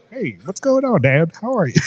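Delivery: high, chirpy little dog voice; talking dog. Pretend. Host imagines dogs talking to their owners, high little voice. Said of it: "Like, they have a little".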